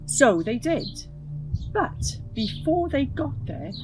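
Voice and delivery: ordinary delivery, nothing marked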